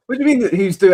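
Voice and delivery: high, loud incredulous retort; retold outrage. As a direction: throw the question back at her, outraged. Retelling confronting the neighbour.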